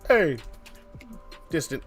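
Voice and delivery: normal talking voice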